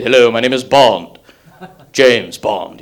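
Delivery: low suave secret agent, lightly done; smooth Bond. Retold or imitated. Admiring the suave hero, a lightly low smooth Bond. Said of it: "smooth, sophisticated".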